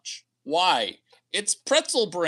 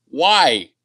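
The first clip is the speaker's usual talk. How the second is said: incredulous why; 9 dB louder than usual. Retold or imitated. Incredulous reply to ex.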